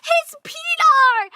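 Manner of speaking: high, loud, drawn-out wail; stretched, high-pitched cry of disbelief; shocked wail. Real, not acted. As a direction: squeal it slowly in horrified amusement. Still shocked at the clip.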